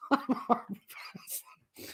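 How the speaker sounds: wheezing it out through laughter; voiceless squeak of laughter; helpless laughter. Real, not acted. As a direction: barely get it out, softly, breathless with laughing.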